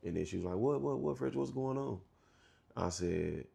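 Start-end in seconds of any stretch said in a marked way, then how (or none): 0.6-2.0 stepmom, asked for the truth, answers with a startled higher What. Retold or imitated. Stepmom caught off guard by question.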